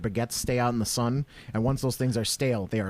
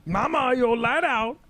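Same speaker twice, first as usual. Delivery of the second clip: high, slow cartoon holler; Princess and the Frog character; 9 semitones higher, slower than usual. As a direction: call out in a drawn-out animated-movie voice. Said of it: "are you doing Princess and the Frog".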